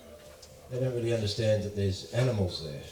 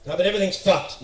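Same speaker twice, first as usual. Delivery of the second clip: louder, higher outburst in imitation of panic; mock freak-out; 7 semitones higher, 6 dB louder, faster than usual. Australian guest protesting to calm host, mock freak-out. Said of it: "I'm fucking freaking out".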